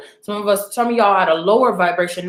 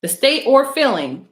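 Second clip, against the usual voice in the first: ordinary delivery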